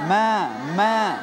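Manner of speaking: ম্যা drawn slow and light; thin মা. Shown as a demonstration. Teacher models the thin মা for viewers. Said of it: "মা পাতলা হরফ".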